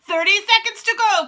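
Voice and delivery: high, loud countdown call; ringing witch proclamation; triumphant cry. A narrator's reading. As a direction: cry out the time to the room. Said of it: "cried the Grand High Witch".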